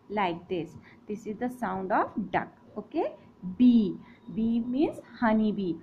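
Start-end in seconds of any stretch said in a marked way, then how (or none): none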